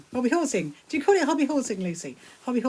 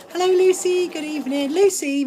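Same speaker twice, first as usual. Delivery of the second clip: high, loud sing-out welcome; raised, enthusiastic greeting; delighted greeting; 8 semitones higher, 6 dB louder than usual. Real, not acted. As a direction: call out hello to a friend arriving. Greets viewer joining the live.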